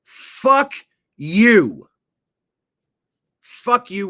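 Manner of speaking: loud, high shouted curse; defiant shout. Shown as a demonstration. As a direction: yell it straight at them. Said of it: "we have to start telling people".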